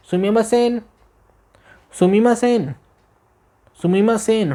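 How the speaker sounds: high falling "सुमीमा सेन" repeated to catch a waiter; calling out politely. Shown as a demonstration. Teacher shows the tone for calling a waiter, high and falling. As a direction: call "सुमीमा सेन" high with a falling end each time. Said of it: "tone सुनो, tone".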